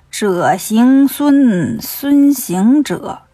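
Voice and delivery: very slow, drawn-out syllables; slow pondering. Acted. Puzzled demon king mulling a familiar name, drawn-out musing. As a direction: turn the name over slowly, stretching each syllable.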